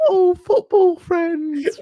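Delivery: very high, slow, drawn-out silly voice; mocking silly voice. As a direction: send up the line in a ridiculous high voice. Mocking Celebrimbor's reply.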